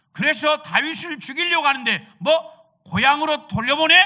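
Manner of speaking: loud, high outraged shout; angry father. Retold or imitated. Saul shouting at Jonathan for sending David home, outraged and loud. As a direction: yell it like a betrayed king. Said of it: "이러면서 막 아버지가 화를 내는 거예요".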